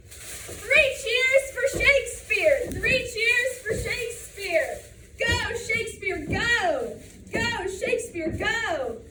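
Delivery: loud, rhythmic pep-rally chant; cheerleader. Acted. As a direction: belt it out like a cheer squad. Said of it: "My brother is a cheerleader".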